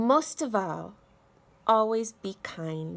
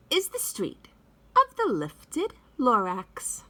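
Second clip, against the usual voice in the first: lively read-aloud with big swoops in pitch; storybook reading. A narrator's reading. Teacher reading The Lorax to children, expressive read-aloud voice. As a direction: read it like story time for little kids.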